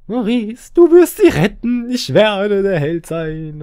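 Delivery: high, loud, drawn-out heroic proclamation; mock hero. Hero vowing to rescue the child, hammed-up and grand. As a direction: slowly overplay the would-be saviour.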